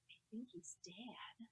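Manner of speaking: tentative whisper, barely there; hushed whisper. Retold or imitated. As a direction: whisper it to someone mid-frenzy. Lady gently interrupts the wailing.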